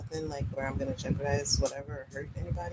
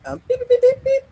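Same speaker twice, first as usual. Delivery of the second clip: loud high bleeps; censor beeps; 17 dB louder, faster than usual. Shown as a demonstration. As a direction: bleep out the cuss word. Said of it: "I'm trying to say M or F-er".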